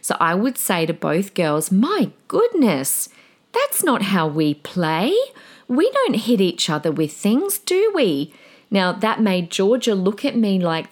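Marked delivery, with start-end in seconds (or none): 1.7-8.3 slow, gentle but firm, rising to a sweet question; soothing yet firm, never mean; soothing teacher voice. Shown as a demonstration. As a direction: kind teacher steering two little girls. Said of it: "use a soothing teacher voice".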